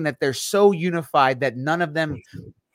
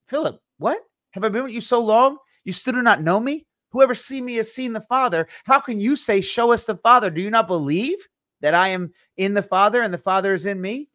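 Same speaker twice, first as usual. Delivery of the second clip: higher-pitched disbelieving plea to Philip; exasperated Jesus; 4 semitones higher than usual. Acted. Jesus pleading with doubting Philip, exasperated and higher. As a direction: play it as can-you-believe-this frustration. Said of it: "He looks at him like".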